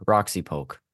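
usual speaking voice

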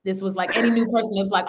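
usual speaking voice

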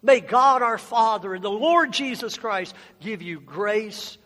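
ordinary talk

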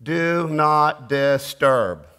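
very slow, deliberate; slow calm sign. Shown as a demonstration. Preacher modelling rest amid chaos, very slow. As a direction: spell it out slowly. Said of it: "hit off, off, and off".

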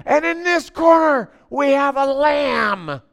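loud, high announcer call, very slow on "a lamb"; announcer shouting the lamb's corner; fight announcer. Retold or imitated. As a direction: boom the lamb's intro like a ring announcer. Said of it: "And then he goes".

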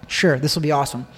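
normal talking voice

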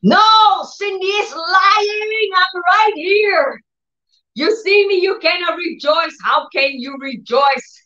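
a mocking disease voice shrieking high and slow; screaming disease. The disease screams at the sick, in a high shouted taunt. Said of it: "is screaming so loud".

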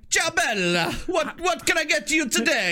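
high-pitched hammed-up Italian waiter; mock Italian accent. Italian waiter in a Chinese restaurant, mock Italian accent. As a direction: greet diners in a comic Italian accent. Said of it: "Chinese restaurant that only hires Italian".

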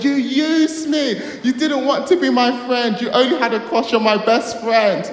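high, loud, hammed-up accusation; dramatic scream. Schoolboy confronting a girl in front of the class, screaming. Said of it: "I screamed at her and I said".

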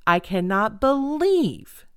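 slow build to a long, punched "believe"; stress on BELIEVE. Retold or imitated. Re-enacting the rant from the other day, stress on "believe". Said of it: "I said it every conceivable way".

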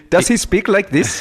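high, slow Scottish impression; mock Scottish accent. Listener teases storyteller about his Scottish guy, mock Scottish accent. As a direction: put on an exaggerated Scottish voice. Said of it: "I foreshadowed a Scottish guy".

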